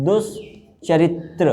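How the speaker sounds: दुष्चरित्र said slowly; slow dictation. Shown as a demonstration. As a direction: stretch दुष्चरित्र for writing. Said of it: "ये नहीं होता".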